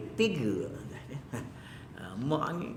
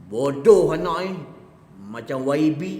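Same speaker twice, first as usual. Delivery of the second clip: mock scolding mother; 8 dB louder, slower than usual. Mother scolding her child.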